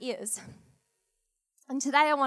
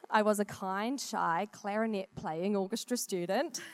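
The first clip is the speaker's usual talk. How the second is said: ordinary talk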